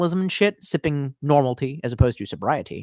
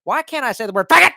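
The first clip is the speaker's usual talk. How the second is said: Dave Chappelle impression; 8 semitones higher, 4 dB louder than usual. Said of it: "Dave Chappelle skit where he's just like".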